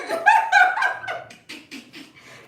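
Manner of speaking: high, loud burst of laughter. Real, not acted. Laughing with Ramona, loud high laugh. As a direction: throw back your head and laugh.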